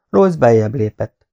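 ordinary delivery, nothing marked

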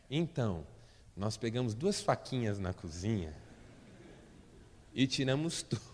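normal talking voice

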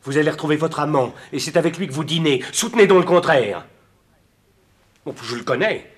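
loud, high, furious accusation; jealous outburst. Acted. Jealous lover accusing Clotilde of a secret affair, shouting.

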